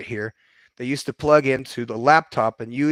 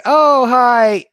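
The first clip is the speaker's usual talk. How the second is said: put-on surprised rich girls; 10 semitones higher, 8 dB louder than usual. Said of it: "And they would go".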